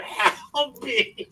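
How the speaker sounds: high, drawn-out mock scream, half laughing; retold scream. Wrestler in a bear hug pleading, high mock scream. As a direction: imitate a strained cry for help without the volume. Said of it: "Kenzie's screaming at my wife".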